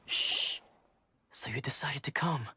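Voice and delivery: hushed whisper, keep it down; don't wake the master. Acted. Disciple greeting visitors secretly, whispering.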